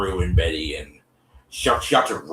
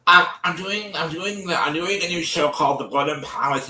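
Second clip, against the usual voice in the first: much higher put-on voice, a bit louder; [name] pitching; 10 semitones higher, 4 dB louder than usual. [name] tells B about the new show, high voice.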